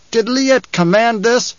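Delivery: high, slow challenge; challenging from above. Acted. Man in the rocks questions the order, challenging. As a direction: question your leader's authority.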